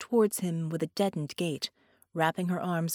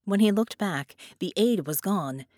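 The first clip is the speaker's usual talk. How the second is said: usual speaking voice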